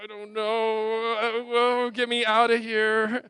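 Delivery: high, slow, panicked waiter; flustered waiter panic. Retold or imitated. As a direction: act out a flustered waiter wanting to escape. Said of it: "they're just like".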